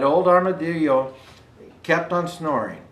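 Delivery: plain reading voice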